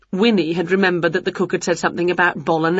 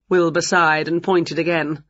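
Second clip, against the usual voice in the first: ordinary delivery, nothing marked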